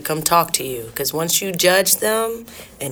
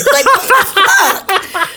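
laughing; 13 semitones higher, much slower than usual. Real, not acted. Reacting to own bit, laughing.

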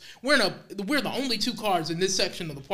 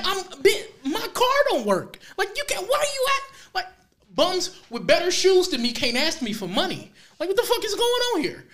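high-pitched comic indignation; exasperated rant; 11 semitones higher, 4 dB louder than usual. Retold or imitated. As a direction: protest in disbelief, half laughing. Exasperated rant about being asked.

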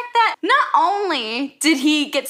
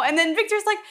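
ordinary talk, nothing marked

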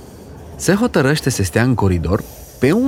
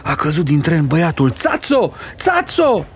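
high, wailing calls of alarm; panicked cry; 5 semitones higher than usual. Acted. Lady telling her sister the boy fell, panicked cry. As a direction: rise into a frantic high cry.

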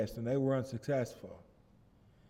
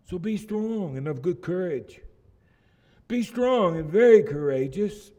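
loud, high, impassioned preaching of the Lord's charge; preaching at full force; 4 semitones higher, 7 dB louder than usual. Acted. Pastor proclaims God's charge to Joshua, preaching loud and fervent. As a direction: thunder it out like a revival preacher.

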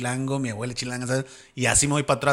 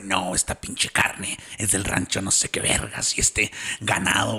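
lower, slow, boastful rancher voice; mock Monterrey steak lover, low and slow; mocking Monterrey meat snob; 6 semitones lower, 3 dB quieter than usual. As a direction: brag about the beef like a proud regio. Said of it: "topas a alguien de Monterrey".

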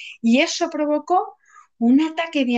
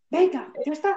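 ordinary delivery

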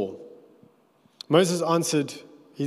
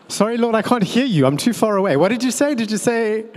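much higher, louder, fast faked calling out; mock calling from afar; 8 semitones higher, 7 dB louder, faster than usual. Moses dodging God's order, calling out as if from afar. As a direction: pretend you're too far away to hear. Moses pretending not to hear God.

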